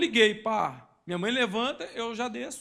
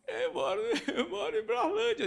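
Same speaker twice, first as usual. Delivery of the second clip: put-on high, timid little boy voice; small child voice; 11 semitones higher than usual. Lost boy answering the policeman, high timid child voice. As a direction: answer the officer like a scared kid.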